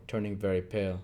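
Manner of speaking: normal talking voice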